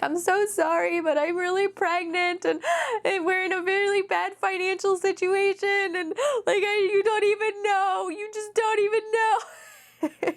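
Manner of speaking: high, tearful pleading through sobs; crying apology. Retold or imitated. Pregnant caller begging the insurance agent, tearful. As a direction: plead through tears, voice breaking. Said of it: "and I started crying".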